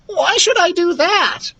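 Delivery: very high, squeaky protest; whining Lucas voice. A narrator's reading. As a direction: balk in a little high voice. Lucas balks at the order.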